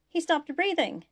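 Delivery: high, loud cry of alarm. A narrator's reading. Tripp alerting the crew as Malcolm stops breathing, alarmed. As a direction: raise the alarm urgently.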